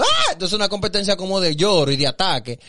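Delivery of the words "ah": short high mock wail; exaggerated high sob noise; imitated crying wail. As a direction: cry out like a rival mourner. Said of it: "otra que está llorando".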